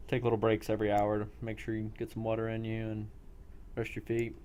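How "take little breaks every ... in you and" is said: ordinary talk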